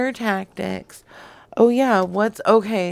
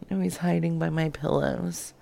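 normal talking voice

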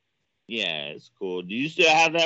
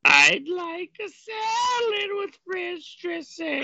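mock wailing. Wailing ranch bit.

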